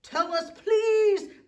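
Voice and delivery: high, loud demanding please; giants shouting. Acted. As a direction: yell the please at full volume. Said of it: "the rude giants shouted".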